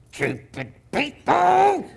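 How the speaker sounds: high, slow furious yell at the phone; angry outburst. Retold or imitated. Caller fuming at the people who cancelled, in a high yell. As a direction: spit it out in a rage.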